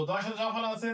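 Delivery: ordinary talk, nothing marked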